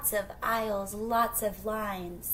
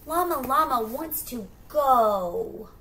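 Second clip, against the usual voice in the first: whiny llama; 3 semitones higher, 8 dB louder than usual. Acted. Llama whining to leave.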